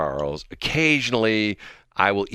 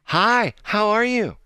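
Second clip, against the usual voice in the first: high, slow, smiley greeting; friendly lady impression; 13 semitones higher, 3 dB louder, slower than usual. Host voicing the smiling lady in the booth, sugary imitation. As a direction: mimic an over-sweet stranger saying hello. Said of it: "she smiles at me. She says".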